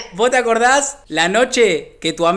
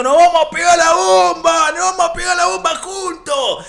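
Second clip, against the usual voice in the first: higher, louder crazed yell of the biker; higher louder mock biker yell; manic biker shout; 8 semitones higher, 4 dB louder than usual. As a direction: shout it like the reckless rider.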